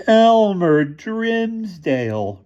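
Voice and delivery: high, slow drawn-out howl. A narrator's reading. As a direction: howl the name in dismay. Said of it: "howled in unison".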